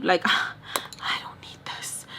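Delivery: soft, slow, sighed-out whisper; sighing whisper. Retold or imitated. Retelling wanting to walk out on him, a slow sighing whisper. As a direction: let it out on a tired breath, barely voiced. Fed up, about to leave.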